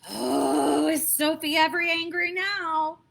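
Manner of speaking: ordinary delivery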